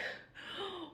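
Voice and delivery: sharp, high intake of breath; shocked gasp. Retold or imitated. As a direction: gasp as if scandalised. Hearing the blackmail threat.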